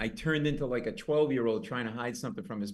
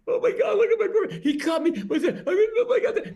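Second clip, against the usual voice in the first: very high, loud shrieking mock panic; hammed-up screaming girlfriend; hysterical girlfriend; 7 dB louder than usual. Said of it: "his girlfriend's like".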